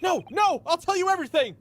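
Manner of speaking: high, loud pleading; mock terror. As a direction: beg in theatrical fright. Voicing terrified hero.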